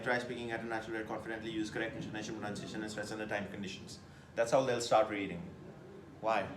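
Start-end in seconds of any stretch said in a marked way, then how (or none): 0.0-4.0 fast, low robotic read-out; rushed emotionless monotone. Shown as a demonstration. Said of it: "sit like a robot and they'll start reading".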